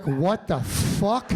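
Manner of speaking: low, slow, laughing what the fuck; mock baffled look. Comic voicing Nate's baffled look at the joke, low and laughing. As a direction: drop the pitch and drag it out through a laugh. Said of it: "Nate just looked at me like".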